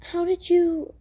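plain reading voice

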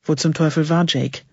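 plain reading voice

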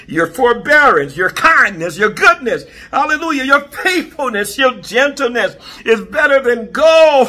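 high, loud, fervent preacher's delivery; full-force preaching. Real, not acted. Preacher proclaiming the fruit of the Spirit to the congregation, fervent shouted preaching. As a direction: ring out each phrase with mounting praise.

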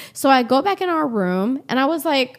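ordinary talk, nothing marked

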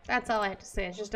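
normal talking voice

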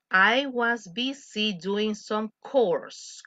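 slow model line. Shown as a demonstration. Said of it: "model dialogue".

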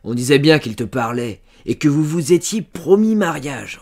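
loud, rising triumphant accusation; sudden exclamation. A narrator's reading. Farmer guessing it is Jacques, exclaiming. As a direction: burst out as if it just dawned on you. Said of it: "l'homme s'écria".

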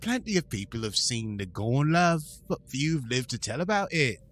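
lightly put-on cockney voice, "love" tacked on; cockney accent. Host doing La'an as a cockney lady. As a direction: play a chummy London barmaid. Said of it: "She's such a sweet little lady".

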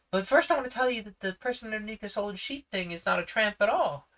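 put-on high, fast, eager girl's voice; a confident girl speaking up; young girl Judy. Judy defends her brother to the policeman, high and eager. Reads Judy's lines.

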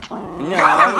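high, loud yelp of no; startled, high protesting yelp. Real, not acted. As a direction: cry out as the pet attacks. Pet attacks the speaker.

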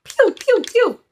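high-pitched playful "pew" sounds; play-fire the nozzle with high little pews; mock spray-gun noises. Vlogger toying with a new garden hose nozzle, mock firing noises.

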